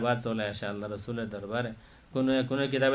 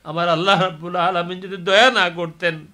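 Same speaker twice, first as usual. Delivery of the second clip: ordinary talk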